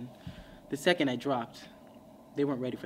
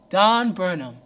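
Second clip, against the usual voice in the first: louder, the name Don Burnham called out and falling; announcing the other self; 2 semitones higher, 9 dB louder, slower than usual. Retold or imitated. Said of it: "that other guy would pop up".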